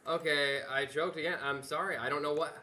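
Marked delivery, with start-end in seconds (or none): none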